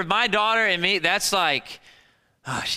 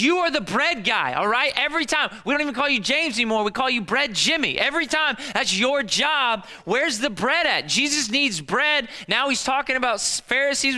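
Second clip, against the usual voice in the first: higher, whiny squabbling voices; bickering disciples; 6 semitones higher than usual. Acted. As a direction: play the disciples arguing over bread. Disciples bickering over forgotten bread.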